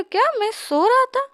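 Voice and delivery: high, startled question; can't believe he slept; shocked disbelief. A narrator's reading. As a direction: prince stunned at hearing he overslept.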